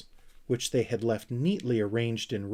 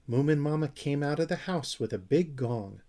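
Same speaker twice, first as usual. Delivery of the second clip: ordinary delivery, nothing marked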